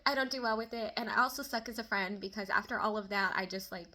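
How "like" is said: ordinary talk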